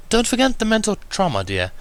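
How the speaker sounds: high Rarity voice with a faint edge of annoyance; polite but mildly irked; refined mare, lightly peeved; a hint of irritation. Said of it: "a hinting of irritation in her voice".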